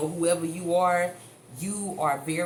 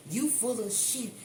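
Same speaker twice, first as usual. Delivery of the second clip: mock drunk, pitched higher and softer; imitating a drunk; 6 semitones higher, 4 dB quieter than usual. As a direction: play the slurring drunk, lightly hammed-up. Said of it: "They say".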